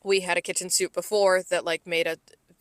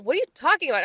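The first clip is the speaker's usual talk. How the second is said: higher-pitched, exasperated retort; 7 semitones higher, much faster than usual. Retold or imitated. To boyfriend talking in the other room, exasperated. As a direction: fire back a puzzled, exasperated question. Acted exchange with muttering boyfriend.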